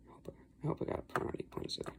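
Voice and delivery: quick hushed whispered aside; whispering. Real, not acted. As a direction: whisper it fast under your breath.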